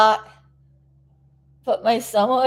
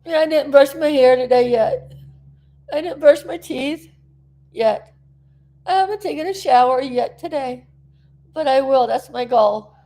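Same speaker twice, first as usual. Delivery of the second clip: ordinary delivery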